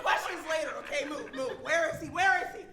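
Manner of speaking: loud commanding hunt for an intruder; urgent demand. Acted. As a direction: push past, demanding loudly. Barging in after intruder.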